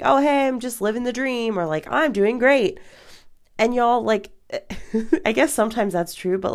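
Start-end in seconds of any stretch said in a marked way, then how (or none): 0.0-1.6 much higher, breezy brush-off; put-on everything's-fine voice; automatic cheery response. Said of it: "my response is gonna be something like". 1.9-2.8 high-pitched pretend cheer; put-on upbeat small-talk voice; automatic cheery response. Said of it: "my response is gonna be something like".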